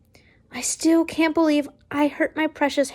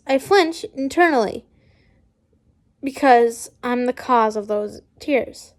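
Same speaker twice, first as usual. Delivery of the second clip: plain reading voice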